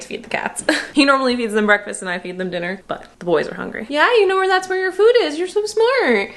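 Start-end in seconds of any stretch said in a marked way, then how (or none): 3.9-6.3 high, loud pet-talk voice. Real, not acted. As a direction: coo at the cats way up high. Talking to her cats.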